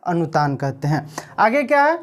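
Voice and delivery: ordinary talk, nothing marked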